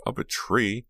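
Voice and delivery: high, teasing punch line; joking. A narrator's reading. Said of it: "Dina joked".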